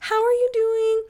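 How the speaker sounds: high, sing-song praise; bright chirpy encouragement for the patient; cheery nurse voice. Retold or imitated. Nurses cheering on the mother in labor, high and sing-song. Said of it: "everyone's coming in and saying".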